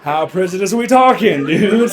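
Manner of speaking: mock tough guy demanding an answer. Retold confrontation at the bar.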